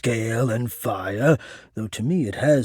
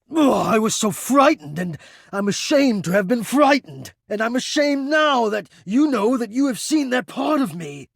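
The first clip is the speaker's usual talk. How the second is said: frightened, ashamed outburst; 11 semitones higher than usual. Acted. Confessing shame to the listener, rising to a high anguished near-shout. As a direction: let the shame and fear spill out, climbing.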